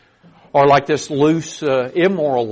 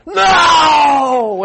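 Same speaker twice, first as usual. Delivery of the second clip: high, loud shouted no; emphatic no; 14 semitones higher, 9 dB louder than usual. Pretend. Fishermen shout back at the stranger, emphatic and loud. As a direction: shout the no at him. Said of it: "the emphatic form".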